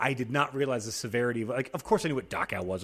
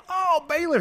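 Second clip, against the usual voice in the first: much higher, sing-song greeting; mock chirpy greeting; 4 dB louder, slower than usual. Greeting the Baylor neighbor, mock chirpy high voice. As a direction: chirp it like a fake-friendly hello.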